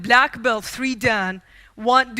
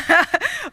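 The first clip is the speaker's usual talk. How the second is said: bitter laugh; 7 semitones higher than usual. Real, not acted. Laughing at father's harsh words.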